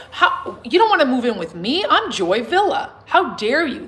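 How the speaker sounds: offended star scoffing through a laugh; mock diva ego. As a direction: ham up the offended diva in a high voice. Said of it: "That's ego".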